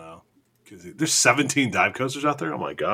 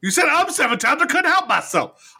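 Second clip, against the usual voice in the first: laughing high protest. Real, not acted. Teased back about saying um.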